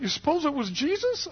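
high-pitched imitation of a wondering old man; Bob. Healed old man asking the helper who did it, high voice. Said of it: "he just starts crying".

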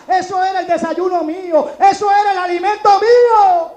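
loud preached storytelling; fiery testimony. Real, not acted. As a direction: preach the story with full force.

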